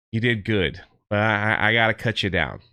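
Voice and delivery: pat the tree and say goodbye gently, low; tender farewell. Retold or imitated. Elf comforts tree before cutting.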